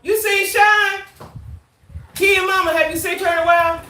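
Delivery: loud, high shout across the house; calling out. Real, not acted. Streamer shouts to JoJo about Sean, loud and high. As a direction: shout to get JoJo's attention.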